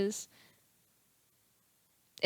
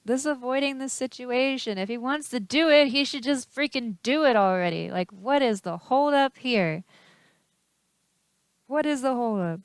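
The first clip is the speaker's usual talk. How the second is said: exasperated; 4 semitones higher, 5 dB louder than usual. Real, not acted. Frustrated rant at stalling.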